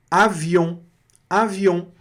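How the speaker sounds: avião ending in nasal on; French nasal on. Shown as a demonstration. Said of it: "Esse on aqui fica on". (avião com on nasal francês)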